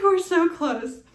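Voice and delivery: high, loud, slow and thrilled; almost squeal it with joy. Retold or imitated. Mother recalling feeling her baby's head, thrilled.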